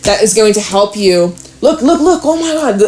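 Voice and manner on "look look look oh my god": quick high-pitched burst of amazement; real startled excitement; excited discovery. Real, not acted. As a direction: react as if you just spotted it, pitch jumping up. Reacts to the cards revealed.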